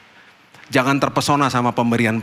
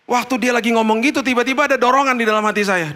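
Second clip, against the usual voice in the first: ordinary delivery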